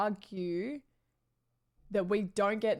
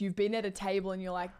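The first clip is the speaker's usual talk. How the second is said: ordinary talk, nothing marked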